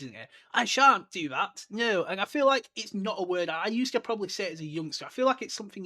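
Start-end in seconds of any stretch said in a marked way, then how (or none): 0.5-2.0 put-on higher, much louder, slow bratty child refusing; bratty Dudley. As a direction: stamp your foot like a spoilt boy. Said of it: "a very Dub-, Dudley word".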